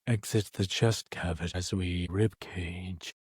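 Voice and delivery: low, drawn-out winded doctor; winded and recovering from a fright; out of breath. Acted. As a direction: answer slowly in a deep, out-of-breath character voice. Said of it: "why are you so out of breath".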